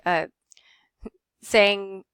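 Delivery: normal talking voice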